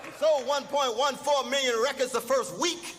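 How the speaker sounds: shouted, high sermon plea to a brother; preaching at full force. Acted. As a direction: call him out from the pulpit, full voice.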